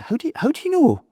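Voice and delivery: can't believe he already knows; astonished question. Retold or imitated.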